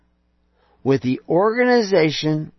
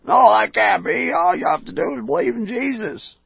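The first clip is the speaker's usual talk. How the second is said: mock whine, higher and louder; whining believers; 9 semitones higher, 4 dB louder than usual. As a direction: imitate protesting churchgoers, whiny. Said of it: "whining and crying like".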